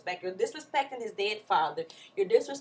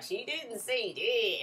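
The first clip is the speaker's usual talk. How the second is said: drawn-out mock denial; much slower than usual. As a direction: stretch each word slowly. Retold denial, mocked as a lie.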